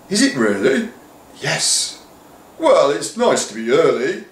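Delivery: put-on cheery, oblivious old voice; befuddled head pleased to be early; dotty headmaster. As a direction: shrug off the mistake brightly. Confused headmaster replies.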